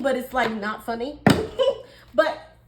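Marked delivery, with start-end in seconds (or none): 2.1-2.6 a sudden high laugh; real giggle breaking out. Real, not acted. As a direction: burst into laughter, then but. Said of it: "I'm laughing because".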